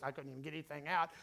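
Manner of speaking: exhausted mumble. Shown as a demonstration. Pastor demonstrating his worn-out talk, low and fast. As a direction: barely get the words out. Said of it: "I was talking like this".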